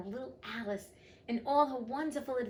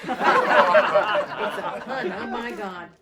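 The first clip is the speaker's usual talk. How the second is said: laughing; 2 semitones higher, 13 dB louder, much slower than usual. Acted. Reader echoing the court's delayed laughter, laughing loudly. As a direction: crack up hard while repeating the line. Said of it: "and everybody laughed".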